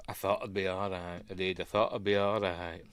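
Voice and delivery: choked up. Real, not acted. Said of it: "Give me a minute".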